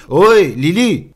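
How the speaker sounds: calling out at full voice, high and loud; hurling a name. Acted. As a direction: hurl it toward the heights. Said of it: "Je vais hurler moi-même vers Lily". (appel hurlé, très fort et aigu)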